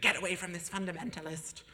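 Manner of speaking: a mild mock sneer. Rival inner voice warning him off, snide. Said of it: "this other voice was like".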